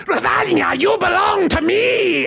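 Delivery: high, drawn-out monstrous shriek; rotten demon woman. Acted. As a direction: screech it slowly to claim your victim. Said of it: "hearing this voice and seeing this rotten woman".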